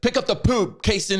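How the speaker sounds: high-pitched mock mom scolding her kid. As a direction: play the nagging mom calling to her kid. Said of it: "like, when a kid gets in trouble".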